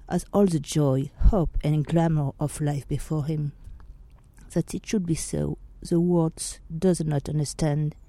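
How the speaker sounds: soft, measured reading of a famous speech; solemn recitation. Acted. Podcaster reads Oscar Wilde's defence to listeners, solemn and hushed. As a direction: read it like a courtroom plea, hushed and grave.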